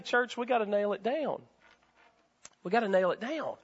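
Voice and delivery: ordinary talk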